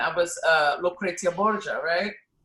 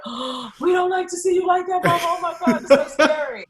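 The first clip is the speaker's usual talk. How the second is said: put-on much higher scared child's voice; imitating her kids; 10 semitones higher, 5 dB louder than usual. Children watching her Tosca trailer, much higher scared kid voice. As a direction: whine like frightened little kids. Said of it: "And they're like".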